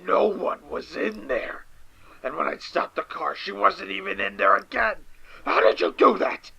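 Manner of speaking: building from shaken to a frightened shout; rising panic. Acted. Caller panicking at the host.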